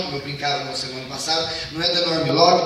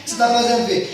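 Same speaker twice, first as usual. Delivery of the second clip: mother scolding; 8 semitones higher, 4 dB louder, faster than usual. Acted. Mother questioning child.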